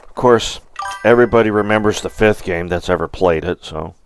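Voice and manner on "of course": ordinary talk, nothing marked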